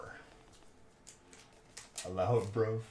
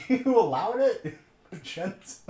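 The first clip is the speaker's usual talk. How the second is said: laughing; 10 semitones higher, 6 dB louder, slower than usual. Real, not acted. Laughing in disbelief at friend.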